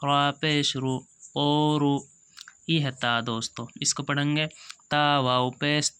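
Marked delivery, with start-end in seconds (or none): none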